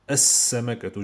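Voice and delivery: "As-Samakatu" with a long doubled s and no L; as-samakatu, hissing s held; L assimilated. Shown as a demonstration. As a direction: go straight into the s of "As-Samakatu". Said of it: "je dis".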